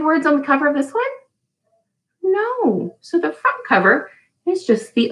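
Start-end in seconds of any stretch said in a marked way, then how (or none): none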